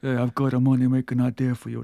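low mumbled Stallone voice; a failed Stallone growl; Sly impression. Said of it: "my Sly impression".

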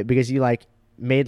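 ordinary delivery

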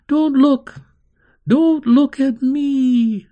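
high, loud, frightened scream; girl screaming in fear at an empty room; terrified scream. Acted. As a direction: shriek it in panic. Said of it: "Carney would scream".

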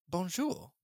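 bonjour repeated as a puzzled question; puzzled echo. Real, not acted. Co-host questioning the odd bonjour, puzzled. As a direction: echo the word bonjour, baffled. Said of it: "Why is he saying hello".

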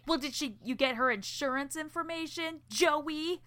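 high-pitched mock indignation; really mad. Katie grills Joey about the insurance information, mock mad. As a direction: act out an exasperated, nagging girlfriend. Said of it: "she's really mad that he didn't".

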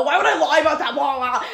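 high, loud mock protest; imitating him pissed. Man called out on his accent, mock-angry. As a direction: rant it back in exaggerated indignation. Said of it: "He got, like, pissed that I called him out".

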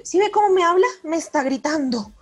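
high, loud tattling to dad; retold outraged little girl; indignant child complaint. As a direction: whine it like a wronged kid telling on the teacher. Child complaining to dad about teacher.

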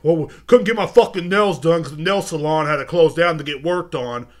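loud imitation of someone ranting; mock rant. Imitating someone ranting about a nail salon, mock-indignant and loud. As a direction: put on an indignant complainer's rant. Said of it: "I saw someone ranting today".

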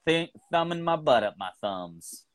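American Southern twang, demonstrated; American accent. Shown as a demonstration. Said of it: "You gotta do an American accent".

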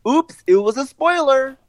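high-pitched mock innocence; mock oops. Imitating an actor who let a spoiler slip, high mock oops.

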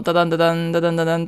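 low, rhythmic train clatter; imitated electric train. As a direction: chant the rattle of rails on a low note. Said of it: "電気の力で走っていく電車". (低めに刻む電車の走行音まね)

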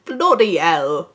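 slow, loud mock-Cockney with dropped h; bad Cockney accent. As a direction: exaggerate a cheesy Cockney accent. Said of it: "a really shit Cockney accent".

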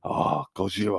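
high comic Japanese Gojira; mock-awed Japanese accent; hammed-up Japanese cry. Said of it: "turned to you and you went".

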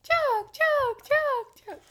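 high, loud repeated bird notes; mock nightingale song. Co-host tries the nightingale's song, high mock birdsong. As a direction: chirp each one high and even. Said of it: "is that the pretty version".

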